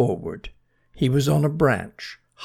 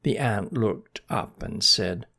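plain reading voice

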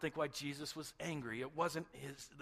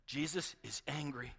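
normal talking voice

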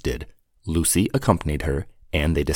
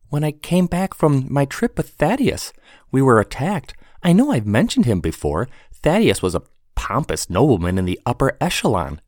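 put-on young woman voice; 8 semitones higher than usual. Sarah tells story.

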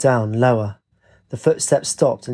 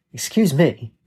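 mildly indignant exclamation; exclaimed; 3 semitones higher, slower than usual. A narrator's reading. Katie with light in her eyes, mild exclamation. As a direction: exclaim it with a touch of affront. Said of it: "She squinted and exclaimed".

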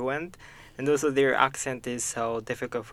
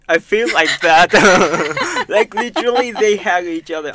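laughing through the words, higher and louder; 5 semitones higher, 11 dB louder than usual. Real, not acted. To friends, amused at all the hugging, laughing. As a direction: let the giggle spill into it.

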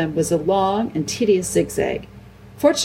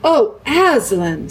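slow, loud, raised cry; heartfelt exclamation; 5 semitones higher, 5 dB louder, much slower than usual. A narrator's reading. Peter kneeling before the lion, heartfelt exclamation. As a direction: draw the name out with feeling.

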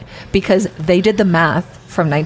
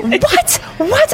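much higher, rising bewildered What; Kyle's confused what. Retold or imitated. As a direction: squeak it out like a startled friend. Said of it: "And he goes".

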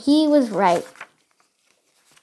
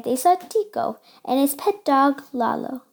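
usual speaking voice

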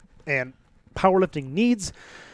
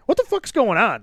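higher-pitched mock exclamation; imitating his own baffled outcry; retold bewilderment; 6 semitones higher, 3 dB louder than usual. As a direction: re-enact being baffled, pitch jumping up. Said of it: "we're all, like, yelling".

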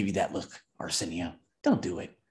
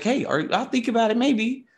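high mimicking of her maybe; mocking echo; 10 semitones higher than usual. Bar guy mimicking Lisa's maybe, high and mocking. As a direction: throw her words back at her. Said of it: "he's like".

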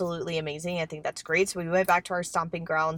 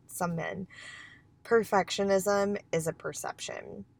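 ordinary talk

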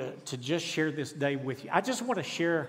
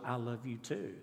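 put-on child's soft reply; 5 semitones lower, 10 dB quieter than usual. Little daughter answers silent lips.

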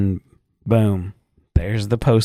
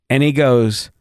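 ordinary talk

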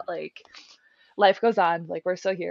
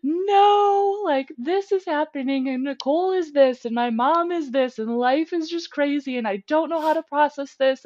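louder and higher, crying shout; retold tearful outburst; 8 semitones higher, 5 dB louder than usual. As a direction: pour it all out sobbing to the boss. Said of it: "And I was like".

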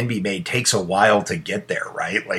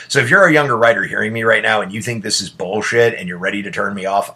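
normal talking voice

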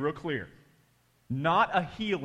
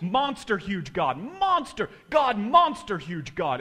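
slow, loud, high exclamations; imitated fervent prayer; 5 semitones higher, 6 dB louder, slower than usual. Pastor repeating how big God is, imitated loud fervour. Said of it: "He kept saying it".

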